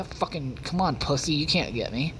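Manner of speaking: ordinary talk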